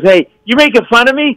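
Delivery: high, imitated drunk confrontation; mock aggrieved challenge. Slashed man to the comic on stage, mock confrontation. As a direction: confront someone as an offended drunk. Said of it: "And he goes".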